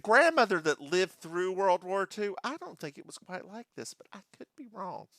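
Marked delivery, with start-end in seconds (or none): 2.4-5.1 breathy, unvoiced aside under her breath; whispered aside. Real, not acted. Host whispering a doubt about grandmother's war stories. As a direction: drop it to a whisper as a cheeky afterthought.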